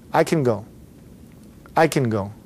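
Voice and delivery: "can" squeezed to "kun", C straight to N; unstressed "can", sounding like "kun"; reduced can. Shown as a demonstration. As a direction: say "can" as a weak, barely voiced schwa. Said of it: "going right from the C to the N".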